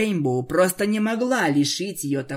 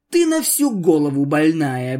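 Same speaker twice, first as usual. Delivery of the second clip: loud disgusted outburst; 6 dB louder than usual. Acted. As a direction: spit it out in disgust. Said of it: "брезгливо сказала Рейнбоу".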